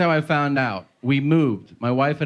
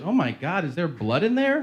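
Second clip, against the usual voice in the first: higher-pitched imitation of a worried wife; mild mock alarm; 6 semitones higher than usual. Wife to husband about the bathroom, mildly alarmed. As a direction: ask it high, a touch horrified.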